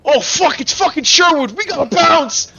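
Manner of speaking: high, loud panicked warning; rising cry of alarm; alarmed shout. Acted. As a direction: yell to your gang to flee. Thug spots approaching figures, calls to flee.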